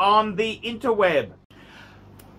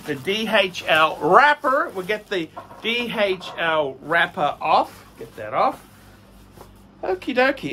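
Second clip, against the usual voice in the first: ordinary talk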